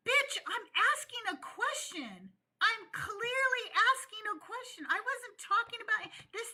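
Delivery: high-pitched, indignant comeback; worked-up, emphatic protest; exasperated retort. Acted. Exasperated comeback to the retold critic.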